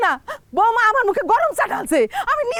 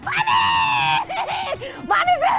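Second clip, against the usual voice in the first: wailing for help; 3 semitones higher than usual. Acted. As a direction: wail out the name for rescue. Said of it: "তোমার চিল্লাচিল্লি শুনে".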